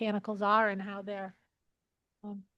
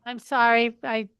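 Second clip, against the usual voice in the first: louder, leaning into the mic; speaking up; 8 dB louder than usual. Real, not acted. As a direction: raise your voice so the room hears. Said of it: "Madam Chair, I can't hear a word".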